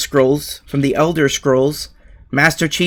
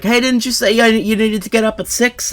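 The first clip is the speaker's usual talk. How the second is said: raised-pitch mock scolding; mild retold yell; 7 semitones higher than usual. Dad waking the streamer who overslept, mild mock yell. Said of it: "he yelled at me".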